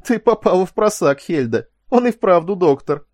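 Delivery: high, loud gloating; hilarious glee. A narrator's reading. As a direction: crow over someone else's blunder. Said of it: "Теки взвыл от смеха".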